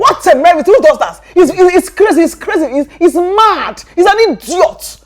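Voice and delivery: high, loud indignant rant; furious friend ranting about the ex; outraged rant. Acted. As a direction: rail against the fool.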